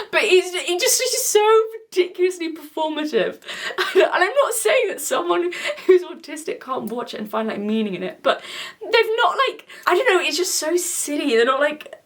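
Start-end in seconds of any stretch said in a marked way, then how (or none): 0.1-8.2 laughing through the words, pitched up; giggling in disbelief. Real, not acted. Vlogger laughing at the performative star.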